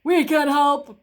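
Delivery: loud soldiers' call. A narrator's reading. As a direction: call out like eager toy soldiers. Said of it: "called a group of toy soldiers".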